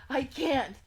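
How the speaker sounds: high, loud desperate cry; frantic wail; panicked protest. Acted. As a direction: burst out helplessly, very loud. Panicked character protests again.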